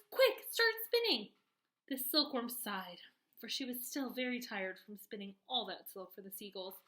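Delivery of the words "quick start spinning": loud high urgent command; urgent yell. A narrator's reading. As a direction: yell at her to hurry. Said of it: "yelled James".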